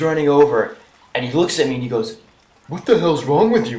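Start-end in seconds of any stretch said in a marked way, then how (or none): 2.7-3.8 higher-pitched indignant confrontation; mock outraged adult. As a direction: imitate an appalled parent scolding a kid. Angry adult confronts the teller.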